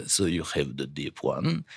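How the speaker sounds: low, slow Russian character; slow, soft Russian bass; deep Russian voice. Shown as a demonstration. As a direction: drop into a deep, quiet Russian accent. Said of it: "I've only got one Russian voice".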